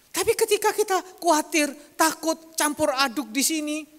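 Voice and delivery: high, loud preacher's build; preaching at full force. Acted. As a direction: lift it into a fervent warning. (berkhotbah dengan semangat penuh)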